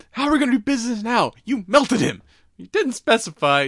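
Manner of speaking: loud, high-pitched scolding; mock exasperation. She scolds him for melting the captive, loud exasperated mock rebuke. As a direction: protest in hammed-up disbelief.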